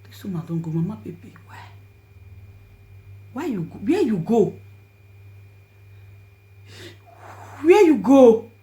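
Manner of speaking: rising anguished cries to a lost friend; grieving cry. Real, not acted. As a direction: call out for her, voice climbing to a wail. Crying out to dead friend.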